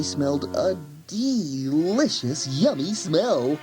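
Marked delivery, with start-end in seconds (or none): none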